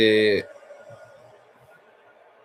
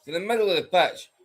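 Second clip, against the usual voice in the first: higher, animated pundit voice; live commentary; 9 semitones higher than usual. Acted. Pundit breaking down the Hibs goal for viewers. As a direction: talk it through like a TV commentator.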